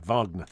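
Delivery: "Wagner" with V and long AH, as VAHG-ner; German pronunciation. Shown as a demonstration. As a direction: say "Wagner" with a V sound at the start. Tries three versions of surname.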